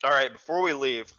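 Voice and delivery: talking while chewing jerky; mouth full. Real, not acted. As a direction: speak through a mouthful of jerky. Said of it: "I'm eating jerky".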